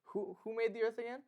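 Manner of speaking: mock-booming God, rising high at the end; high teasing divine voice; playful God voice. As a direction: tease the disciples as a grinning God. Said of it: "And he's like".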